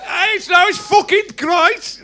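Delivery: high, loud, slow Brummie crow. Retold or imitated. Brummie rocker crowing it's great, high and slow. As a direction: stretch it out like a glam rock Brummie. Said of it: "full Brummie with Slade, [name]".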